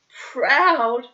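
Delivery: vicious retort. A narrator's reading. Annette throws his word back at Hans, viciously. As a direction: fling the word back at him with venom. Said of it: "she flung at him viciously".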